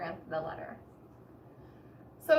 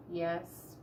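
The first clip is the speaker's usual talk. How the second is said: sad; 6 semitones lower, 6 dB quieter than usual. A narrator's reading. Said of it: "he said sadly".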